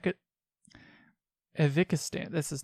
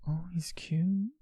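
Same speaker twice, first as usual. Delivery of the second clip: whisper; 2 semitones higher, 5 dB quieter, slower than usual. Real, not acted. Reacting to dating profile photo.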